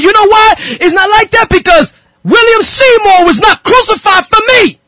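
high, rising preacher's fervor; voice going up, Pentecostal. Real, not acted. Preacher arguing William Seymour wasn't crucified, voice climbing Pentecostal. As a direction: let the sermon lift your voice high. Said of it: "my voice is going up".

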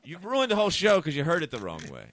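high, loud exasperated rant; retold angry berating. Comedian retelling telling off woman after show, loud exasperation. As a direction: act out chewing out the complainer.

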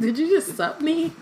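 hammed-up disbelief; Ginny incredulous. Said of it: "Ginny's like".

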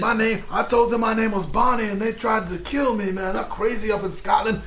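high put-on stoner accent; deliberately terrible accent, played as a sob story; Cheech and Chong. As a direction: complain in a high, botched accent. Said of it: "immediately turns into Cheech and Chong".

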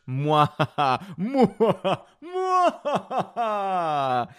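giggling mock kisses, rising high; mad scientist. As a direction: laugh through exaggerated kiss sounds. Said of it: "Laura says".

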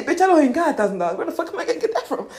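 mock outrage at being asked; indignant mock protest. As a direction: protest quickly in disbelief, half laughing. Voices listener's indignant money objection.

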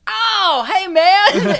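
squeaky guilty hey man; caught out. Retold or imitated. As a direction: play the hustler caught red-handed. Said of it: "and he goes".

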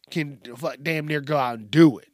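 ordinary delivery

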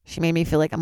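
ordinary delivery

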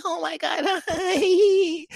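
put-on high, giggly girly voice; hammed-up high squeal of a girl; mock girly greeting. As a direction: play a giddy girl greeting her friend. Mocking girls greeting best friend.

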